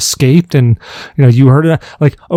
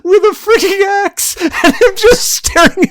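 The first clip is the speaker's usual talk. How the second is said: laughing. Real, not acted. Laughing while picturing scene.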